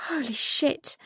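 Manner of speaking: high, hushed gasp of shock. A narrator's reading. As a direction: breathe it out in disbelief. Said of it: "Erin gasped".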